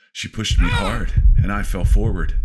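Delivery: loud, high panicked narration; falling scream. Acted. Narrator falling down the borehole, shouting in terror. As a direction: shout it as you fall.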